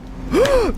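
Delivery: high, shrill-pitched theatrical gasp; mock gasp. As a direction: gasp in fake shock.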